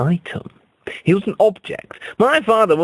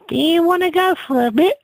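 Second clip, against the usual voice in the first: much higher, lightly put-on come-on; hooker voice; 13 semitones higher, faster than usual. Hooker propositioning the narrator, high mock-flirty voice. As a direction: lift into a coy, high offer.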